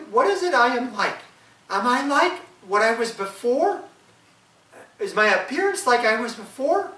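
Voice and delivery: put-on the young wife asking her husband, high and loud. Wife voiced in folk tale.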